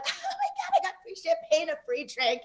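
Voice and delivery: squealing high "Oh my God" that settles down; mock valley-girl squeal; ditzy blonde friend. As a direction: imitate a giddy blonde friend. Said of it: "my little blonde friend's like".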